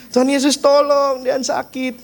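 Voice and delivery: put-on high, slow small child's voice pleading; child crying out to Jesus for help; toddler's cry for help. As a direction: plead like a sick toddler, stretching the words. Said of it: "dia cari Tuhan Yesus gitu kan".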